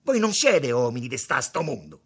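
loud reproach; angry accusation. A narrator's reading. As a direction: accuse them at full voice. Tommaso accusa gli altri. (accusa a voce alta)

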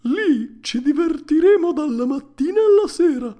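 put-on very loud, high boyish voice, building; Lucignolo. A narrator's reading. Pinocchio quoting his tempting schoolmate, loud and high. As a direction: egg him on, swelling to a big loud finish. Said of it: "Ma Lucignolo mi disse".

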